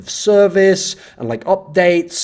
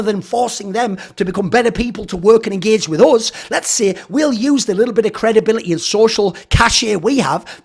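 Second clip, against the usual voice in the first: sarcastic mock pitch; 5 semitones higher than usual. Mocking the industry's cynical pitch.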